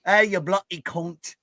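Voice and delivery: put-on sling it like a rowdy Brit, British accent; mock British accent. Mocking how Brits talk, rough British accent. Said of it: "without a British accent".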